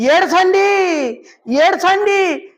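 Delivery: high, loud, drawn-out command; hammed-up loud call to weep; mock preacher shout. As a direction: bellow it into a mic like a showy preacher. Preacher with mic urges crying.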